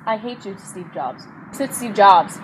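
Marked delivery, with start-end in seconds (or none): 0.1-1.3 muttered quote. Retold or imitated. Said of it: "that makes no sense".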